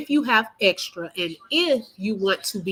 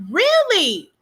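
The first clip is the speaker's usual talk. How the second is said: much higher, louder astonished Really?; surprised; 13 semitones higher, 6 dB louder than usual. Real, not acted. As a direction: jump up in pitch in real surprise. Surprised reply to viewer comment.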